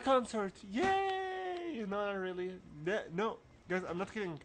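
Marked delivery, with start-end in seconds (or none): 0.7-1.8 cheer. Real, not acted. Cheering at the start.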